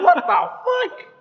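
very high, loud, drawn-out squeal through laughter; mock disbelief. Replying to the old man, laughing incredulous squeal. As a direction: squeal it slowly in laughing outrage. Retold reaction, repeated, laughing.

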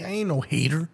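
usual speaking voice

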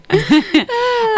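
high burst of laughter; high giggle at her own French joke; big laugh. Real, not acted.

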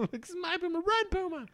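high, soft hammed-up farewell; Free Willy farewell. Owner freeing his puma like Free Willy, high and soft. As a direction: set the animal free like in Free Willy. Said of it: "Free Willy".

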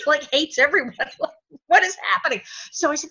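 normal talking voice

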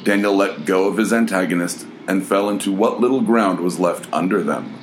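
ordinary delivery, nothing marked

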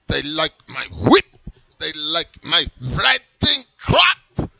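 put-on slow, loud German accent, boastful; bragging strict German voice. Character keeps German voice.

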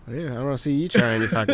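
a lightly put-on Australian accent; a half-hearted Aussie twang, teasing. Trash-talking his friend to show off his Australian accent. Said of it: "suck at doing their accent".

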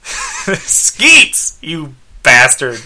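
jokey exaggerated cursing; mock outburst. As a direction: ham up an indignant yell, drawing out the last word.

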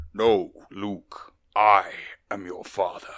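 low, slow Darth Vader voice; booming dark lord, slow and heavy; Darth Vader impression. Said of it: "we knew it had to be Star Wars".

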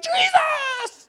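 very high, piercing scream; panicked yell. Acted. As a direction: scream the name in hammed-up terror. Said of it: "And now they're yelling".

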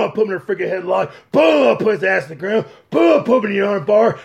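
normal talking voice

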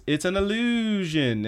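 high, drawn-out mock-mystical announcement; slow, swooping showmanship; showy cover-up. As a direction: stretch it out like a stage magician. Covers up power with showy excuse.

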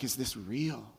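soft, disbelieving hush; a hushed, stunned survivor; awed hush. Retold or imitated. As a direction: breathe it like you can't believe it. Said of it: "they walk out like".

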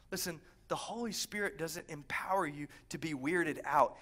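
ordinary delivery

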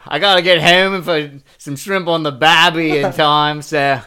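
loud, high, hammed-up Aussie accent; over-the-top Australian shout to the boss. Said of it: "I just act like an offensive".

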